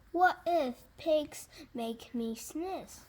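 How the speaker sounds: ordinary delivery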